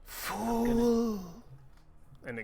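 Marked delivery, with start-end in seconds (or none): 0.0-1.2 high, drawn-out put-on voice; creature's high scornful cry; staff's voice. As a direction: stretch the word out, pitched well up. Game master voices the defeated staff.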